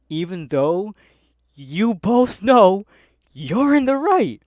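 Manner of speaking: building to a high, loud shout; exasperated rant. Real, not acted. Worker venting about managers siding with customers, exasperated shout. Ranting about a pet peeve.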